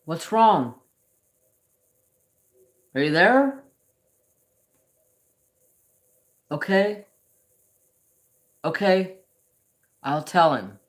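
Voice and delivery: high, loud calling out to someone unseen; dad talking to his late wife; dad answering mom. Retold or imitated. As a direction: call out like answering a voice nobody else hears. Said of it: "all of a sudden he'll go".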